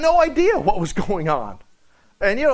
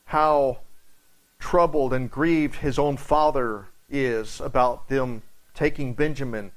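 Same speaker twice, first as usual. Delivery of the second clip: ordinary talk, nothing marked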